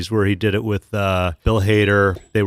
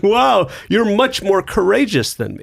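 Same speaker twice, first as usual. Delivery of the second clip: mock awe; 8 semitones higher than usual. Mock-awed reply to comic.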